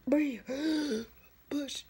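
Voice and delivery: loud, sharp coaching calls. Retold or imitated. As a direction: bark the breathing orders. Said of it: "They were like".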